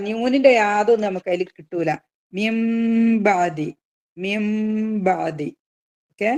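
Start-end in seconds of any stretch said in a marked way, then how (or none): 2.3-5.5 മിംബാദി with nun turned to m, hummed very slowly; iqlab demo. Shown as a demonstration. Teacher showing students iqlab, slow hummed chant. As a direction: hum the m in മിംബാദി long and slow. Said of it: "എന്നിട്ട് മണിക്കുക".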